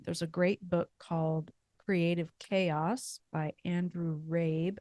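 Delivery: normal talking voice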